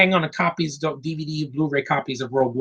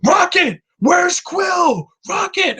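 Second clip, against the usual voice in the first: much higher, louder frantic yell of a name; imitating Drax's shout; 4 dB louder, much slower than usual. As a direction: shout for Rocket like Drax, loud and high. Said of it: "Drax is like".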